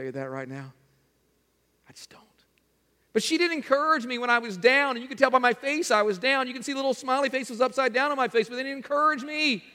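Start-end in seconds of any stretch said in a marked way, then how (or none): 1.9-2.2 a hushed, breathy whisper to the room; whispered aside. Real, not acted. Aside after a rhetorical question. 3.1-9.6 mock-whiny, pitched higher, imitating a hurt churchgoer; whiny complaint.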